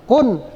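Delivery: "kun" short with a clear n; sermon reading. Shown as a demonstration. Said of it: "kalau kita lagi ceramah".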